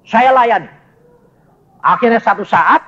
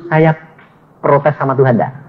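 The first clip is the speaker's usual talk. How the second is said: usual speaking voice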